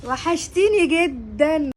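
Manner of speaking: loud, long drawn-out gushing greeting; effusive greeting. Acted. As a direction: stretch it out with delight at seeing your friend. Effusive greeting of a missed friend. (ترحيب حار بصوت عالي وممطوط)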